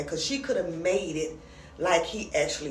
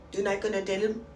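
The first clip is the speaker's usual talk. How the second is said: attempted African accent; 3 semitones higher, faster than usual. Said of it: "I can't do the African voice".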